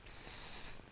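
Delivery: tiny unvoiced psst; timid kitten's whisper. A narrator's reading. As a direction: barely breathe it out. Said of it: "he said in a whisper".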